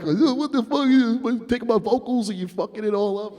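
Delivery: high, loud mock outrage. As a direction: imitate an indignant artist complaining about the mix. Acts out [name]'s outraged complaint.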